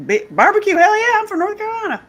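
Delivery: high, loud, delighted outburst; eager misunderstanding. Retold or imitated. Woman mistaking BBC for barbecue, loudly excited. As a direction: burst out with hammed-up enthusiasm.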